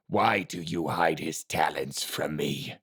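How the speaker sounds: slow, low, soft gravelly master. A narrator's reading. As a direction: question him slowly and darkly. Silent Master voice.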